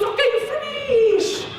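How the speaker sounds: put-on very high, jubilant Chinese-accented shriek; hammed-up Chinese examiner, thrilled; thick Chinese accent. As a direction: cry out in an exaggerated Chinese accent. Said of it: "she had a really thick Chinese accent".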